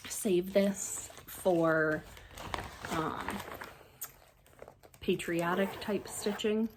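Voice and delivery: ordinary delivery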